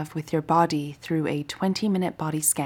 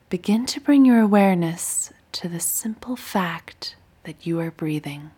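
guided meditation. Acted. Teacher guiding listeners lying down through a body scan, meditative. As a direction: guide them gently through breath and body, unhurried.

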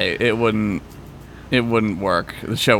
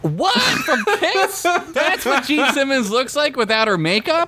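surprised; 13 semitones higher than usual. Real, not acted. Shocked disbelief at Gene Simmons.

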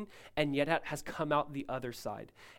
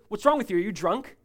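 accusing, louder and higher at first; sharp retold accusation from a priest; judgmental; 4 semitones higher, 7 dB louder than usual. Priest confronts the crying Hannah, judgmental. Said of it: "but with judgment".

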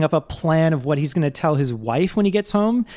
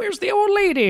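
high-pitched taunting call; hammed-up heckler, pitched way up; mock jeering passers-by. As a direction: jeer in a squeaky put-on voice. Acts out jeering trash-throwers.

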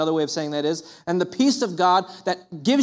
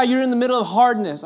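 usual speaking voice

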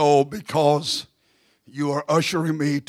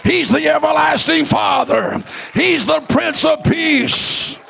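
whooping preacher, sung and shouted; preaching; 12 semitones higher, 8 dB louder than usual. Acted. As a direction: chant the names of God, rising. Preaching climax with music.

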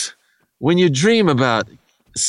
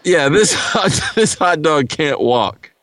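ordinary delivery

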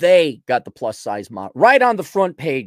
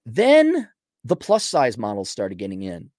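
usual speaking voice

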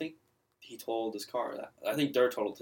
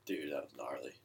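lower, softer, dazed murmur; laid-back dazed voice; 8 semitones lower, 11 dB quieter than usual. Retold or imitated. After the crash he tells his friend, in a low dazed voice. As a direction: mumble it, still stunned. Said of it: "And I'm like".

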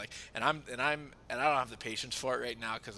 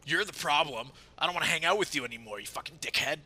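louder, fast imitated angry friend; mock angry outburst; 5 semitones higher, 9 dB louder than usual. Quoting the friend on the phone, mock angry outburst. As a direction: snap it at him like a grudge.

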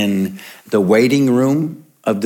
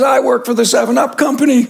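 panicked father; 12 semitones higher, much faster than usual. Retold or imitated. Third father's dismay.

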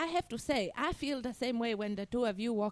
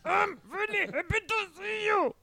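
excited dog voice; 6 semitones higher than usual. Pretend. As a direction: shout it like an overjoyed dog. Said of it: "He's going".

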